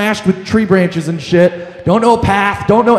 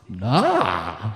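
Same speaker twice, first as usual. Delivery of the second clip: deadpan nah; 11 semitones lower, 9 dB quieter than usual. Retold or imitated. Flat denial to dad.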